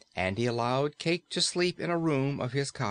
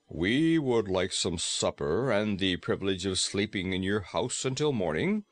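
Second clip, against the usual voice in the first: a lightly voiced pompous frog, lower; Frogman voice; 3 semitones lower than usual. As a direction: speak as the proud Frogman, calm and formal.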